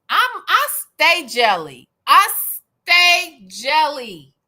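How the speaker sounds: loud, punched "stay jelly"; emphatic mock boast. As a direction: stretch "stay jelly" word by word. Comic boast of being jealous.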